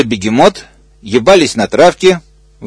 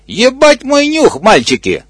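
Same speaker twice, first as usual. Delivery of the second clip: camp ostrich; 12 semitones higher than usual. Acted. Ostrich agrees, squeaky voice.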